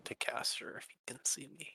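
unvoiced whisper so the visitor won't hear. Real, not acted. Player whispering to the table as a stranger knocks. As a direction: breathe it out, no voice at all.

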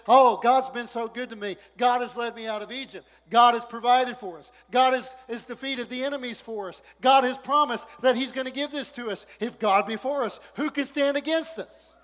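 loud, high joyful testimony; Caleb's joyful faith. Retold or imitated. Caleb praising God before the giants, exultant. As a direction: proclaim God's goodness with delight. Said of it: "he looks at me and he says".

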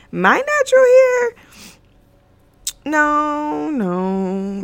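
high, loud incredulous squeal; mock disbelief. Vlogger mocking the idea that this is her natural hair, high incredulous squeal.

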